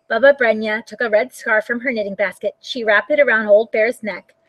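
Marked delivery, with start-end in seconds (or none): none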